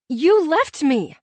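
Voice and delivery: high, loud, slow outburst; hurt cry of accusation; cried out. A narrator's reading. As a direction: heroine crying out at the man who left her. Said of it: "I cried".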